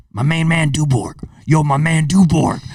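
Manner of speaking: lightly voiced hype call to a buddy; a hint of an excited street greeting; imitating Kenny's greeting. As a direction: mimic a friend greeting you by name. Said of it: "Like this".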